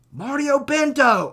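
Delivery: drawn-out, high, loud interviewer call; Michael Bisping. Retold or imitated. Podcaster mimics the commentator greeting the winner, hammed-up shout. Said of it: "Michael Bisping's like".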